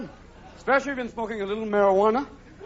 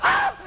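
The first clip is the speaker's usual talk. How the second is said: sudden very high shriek; startled scream; 4 dB louder than usual. Acted. Comedian shrieking as Sasquatch grabs Betty. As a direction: shriek like something grabbed you.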